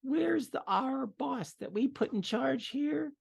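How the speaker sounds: mock-polite little goblin lady; female goblin voice.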